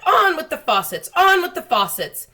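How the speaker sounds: high, loud bellowing order; shouted. Acted. The cloud man ordering the rain, high loud shout. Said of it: "it shouted".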